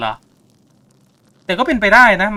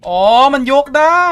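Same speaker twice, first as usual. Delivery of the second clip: higher, louder sudden discovery; surprised realization; 10 semitones higher, 6 dB louder than usual. Real, not acted. Gamer discovering the piece lifts, surprised. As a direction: suddenly get it.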